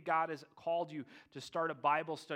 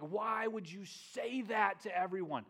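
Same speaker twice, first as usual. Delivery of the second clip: high, slow, exasperated complaint; dismayed protest; 5 semitones higher, slower than usual. Retold or imitated. Disciples questioning Jesus's public remarks, high exasperated protest. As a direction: draw it out as an appalled follower.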